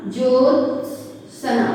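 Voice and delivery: slow dictation. Shown as a demonstration. Teacher dictating the correct word, very slowly and softly. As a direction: draw it out gently so they can write. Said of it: "इसको कैसे लिखना चाहिए बच्चों?".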